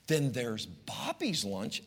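slow, soft, sweetly teasing mother; sing-song mom. Retold or imitated. Mother to her son, slow and sing-song. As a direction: lay out each lunch slowly and sweetly. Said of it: "this is how she spoke to me".

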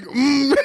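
high, loud imitated straining "mm"; mock desperate groan dissolving into laughter; strained groan. Imitates dad straining to hold it.

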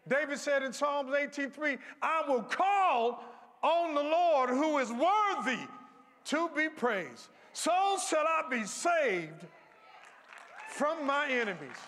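high, loud, slow whooping preacher cadence; full-force gospel whoop; preaching at full force. Acted. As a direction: lift the congregation, drawn out and loud.

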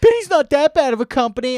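high, loud mocking impression; mock his defense of Bing. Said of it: "You're like".

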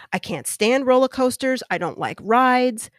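ordinary talk, nothing marked

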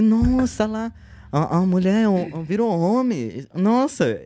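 retelling the shock of first seeing it; amazed. Retold or imitated.

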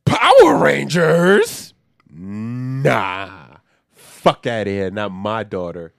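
a hammed-up overprotective dad refusing; dismissive stern dad. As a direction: shut the idea down as the protective father. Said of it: "the dad is like".

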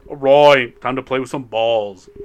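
loud, booming game kickoff; loud announcement. Real, not acted. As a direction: announce it to the room. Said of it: "I just said".

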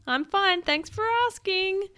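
put-on high, chirpy polite girl; well-mannered girl, pitched high; polite reassurance. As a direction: answer politely in a lifted storybook voice. Floating girl politely reassures window cleaner.

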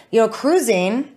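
ordinary talk, nothing marked

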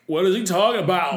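high, loud put-on bewilderment; mock outraged boyfriend. Boyfriend reacting to the confession, mock outraged. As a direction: blurt it out, hammed-up and high.

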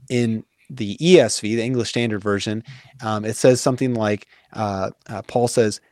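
ordinary talk, nothing marked

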